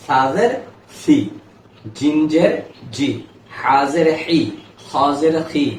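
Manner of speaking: teacher models each letter with kasra for students to copy; "জিন জের জি", "হা জের হি", "খ জের খি" spelled out one by one; reciting letter drills. Shown as a demonstration. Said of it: "সা নরম".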